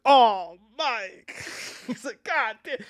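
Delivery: imitating Martin Lawrence. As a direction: squeal it like the panicked partner.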